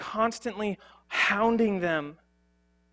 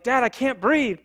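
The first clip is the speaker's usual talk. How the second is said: son pleading; 6 semitones higher, 7 dB louder than usual. Retold or imitated. Son protesting during tickling.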